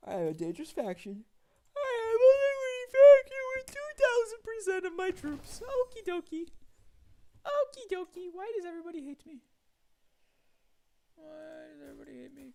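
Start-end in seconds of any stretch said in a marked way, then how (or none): 1.7-8.3 much higher, much louder whiny mock cry; mock wail. Despair at huge faction. 8.3-12.5 high, put-on whimpering voice; mock whine. Self-pitying whine.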